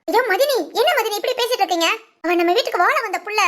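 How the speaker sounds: high-pitched, pleading objection; dismayed protest. Acted. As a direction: remonstrate in dismay on someone's behalf. Dismayed protest defending daughter-in-law.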